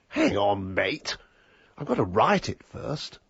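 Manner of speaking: imitating a mildly affronted writer; retold protest, as if the listener is at fault; blame-shifting indignation. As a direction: put the blame back on them, mildly. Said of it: "the way he said it, it was my fault".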